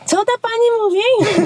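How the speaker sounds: high, put-on kid's question; puzzled children. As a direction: imitate baffled kids in a high voice. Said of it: "I te dzieci".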